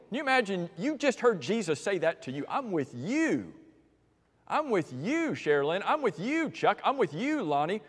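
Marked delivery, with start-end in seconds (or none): 2.5-7.8 tender reassurance. Acted. Jesus voiced speaking to congregation.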